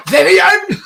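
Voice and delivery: high, loud character yell; high-pitched imitation shout; mock shout. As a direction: bellow the name like a sitcom character. Shouts a character's name from the show.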